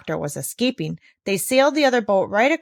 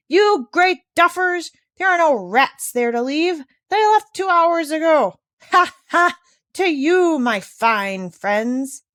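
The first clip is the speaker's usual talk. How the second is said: loud, higher, slow mocking shout; dog shouting; 9 semitones higher, 5 dB louder, slower than usual. A narrator's reading. Dog Jip jeering at sinking pirates, loud mocking shout. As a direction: jeer across the water at the pirates. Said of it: "Jip shouted across from the other ship".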